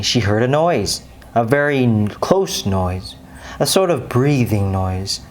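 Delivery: ordinary delivery, nothing marked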